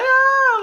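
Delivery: high-pitched mock scream; brief, high scream in a retelling; imitated child's scream. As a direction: imitate a startled daughter's scream. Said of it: "I hear my daughter scream".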